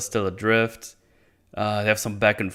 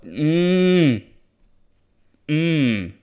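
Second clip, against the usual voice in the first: high, closed-mouth groan of annoyance; frustrated hum; 5 semitones higher than usual. Real, not acted. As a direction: hum out your exasperation, drawn out and raised. Frustrated with the show.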